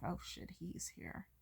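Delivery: slow, hushed aside; disgusted whisper. Retold or imitated. Girl seeing the drunk guy return, a disgusted whisper. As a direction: whisper it under your breath with distaste. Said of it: "a little look of disgust".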